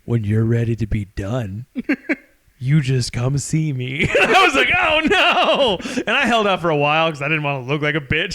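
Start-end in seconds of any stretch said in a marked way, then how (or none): 0.0-4.1 low mock-stern payback; imitated smug buddy. Buddy throws his line back at him. 4.8-5.8 high, loud mock wail; exaggerated comic despair; retold dismay. His dismay at being left in snow.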